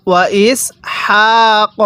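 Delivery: ইসহাক with the ha held one alif long and breathy, very slowly; slow recitation, one-alif stretch. Shown as a demonstration. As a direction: let air out on the ha. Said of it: "এক আলিফ টান দিব".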